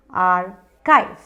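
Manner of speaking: आर्काइव with a hard k; correct pronunciation. Shown as a demonstration. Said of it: "इसे पढ़िए". (आर्काइव में च नहीं, क बोलें)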